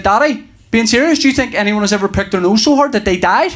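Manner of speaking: lightly voiced small son, in earnest; earnest little boy. Three-year-old puts a serious question to Daddy, lightly voiced child.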